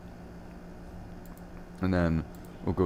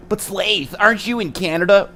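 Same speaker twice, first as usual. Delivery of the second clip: much higher, a put-on questioning chat voice; high voiced viewer; 10 semitones higher, 4 dB louder than usual.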